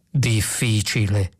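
low, slow deliberate voice; grave thinking machine; ponderous computer. A narrator's reading. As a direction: weigh the word slowly and deep down. Computer answers after a pause.

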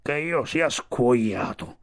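put-on slow, drawn-out old walrus voice; astonished old walrus, dragging the words; Sivic exclaiming. A narrator's reading. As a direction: exclaim it slowly, as a grumpy walrus. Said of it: "esclamò Sivic".

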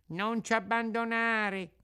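high, long pleading wail; whining fox. Acted. Fox pleads with the departing puppet, wailing. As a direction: beg them not to go. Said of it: "con voce di piagnisteo".

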